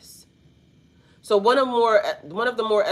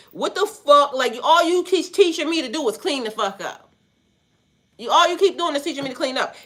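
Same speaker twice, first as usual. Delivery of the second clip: exasperated, higher-pitched student protest; Karate Kid's outburst; 7 semitones higher, 3 dB louder than usual. Acted. Karate Kid complaining to his teacher about cleaning, exasperated. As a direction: blurt it out, fed up and fast. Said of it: "And the Karate Kid said".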